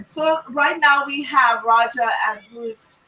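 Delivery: normal talking voice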